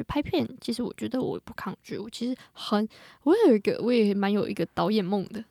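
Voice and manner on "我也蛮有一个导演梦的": usual speaking voice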